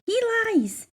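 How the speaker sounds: high, loud warning; warning seashells. A narrator's reading. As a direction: squeak out a warning. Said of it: "the seashells warned".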